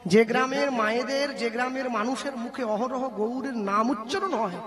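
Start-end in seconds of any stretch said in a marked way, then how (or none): none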